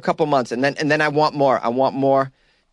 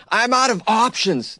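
higher, slow whining "I'm out of options"; whiny mock lament; 9 semitones higher, slower than usual. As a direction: drag it out high like a sulking complaint. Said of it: "That just sounds like he went off the rails".

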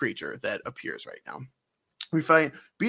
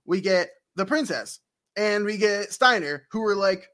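usual speaking voice

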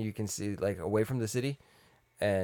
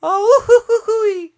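high whooping cartoon laugh; Goofy laugh; 14 dB louder, slower than usual. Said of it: "Goofy laughing".

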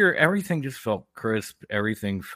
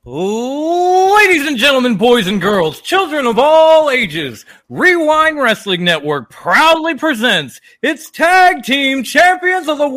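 booming ring announcer, drawn out; ringmaster; 13 semitones higher, 12 dB louder than usual. Acted. Wrestling show intro announcement.

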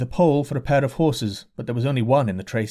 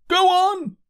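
pushing crowd; 4 dB louder than usual. A narrator's reading. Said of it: "said the people, thrusting at him".

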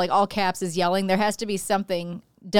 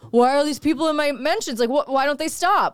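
higher-pitched imitation of griping; mock complaining; 5 semitones higher than usual. Co-host mimics his griping about mentions, mock complaining. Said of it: "gonna bitch about it or say".

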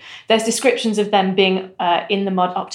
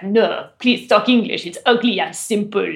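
mock a bad film French accent; 3 semitones higher, 3 dB louder than usual. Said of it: "better than Robert Pattinson's accent".

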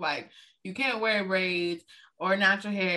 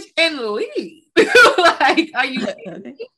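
half-crying laughing scold; laughing mock outrage; 5 semitones higher, 7 dB louder than usual. Scolding a friend at her birthday, laughing outrage. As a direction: cackle through the fake scolding. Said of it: "I'd be like".

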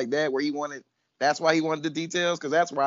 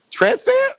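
surprised; 6 dB louder than usual. Retold or imitated. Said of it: "I'm like".

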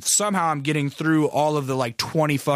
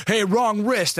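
higher-pitched mock call-out; lightly voiced woman catching someone out; imitated scolding lady; 8 semitones higher, slower than usual. Lady at the wrist scanner stops a guest, imitated scolding call.

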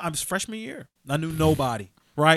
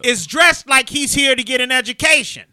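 louder, higher lecturing tone; principal lecturing crowd; 5 semitones higher, 9 dB louder than usual. Retold or imitated. Principal holds the boy up as an example, loud and lecturing. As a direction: scold the crowd while praising him. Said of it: "walks over and goes".

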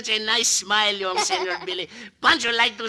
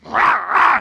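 louder mock growl; wolf growl; 7 dB louder than usual. Boy runs with wolves, louder growl. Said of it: "what run with the wolves".

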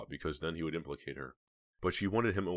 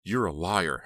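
angry, gritted teeth; 3 semitones higher, 8 dB louder, slower than usual. A narrator's reading. Heather calls him a liar, angrily through gritted teeth. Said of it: "Heather whispered angrily through gritted teeth".

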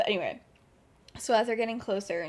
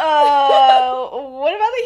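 flustered outburst; 3 semitones higher, 14 dB louder, slower than usual. Retold or imitated. Lily flustered by James's compliment.

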